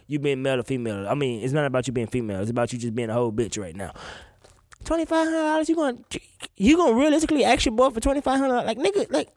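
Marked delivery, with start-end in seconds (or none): 4.9-9.3 indignant disbelief. Real, not acted. Indignant at the request.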